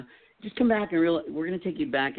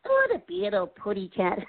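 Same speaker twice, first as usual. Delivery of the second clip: higher, sweet little cartoon bird lisp; 7 semitones higher than usual. Shown as a demonstration. Guest runs through cartoon impressions, high bird lisp. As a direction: lisp it like a tiny cartoon canary.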